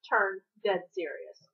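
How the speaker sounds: plain reading voice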